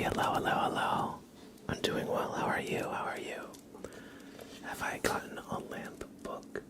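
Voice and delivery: usual speaking voice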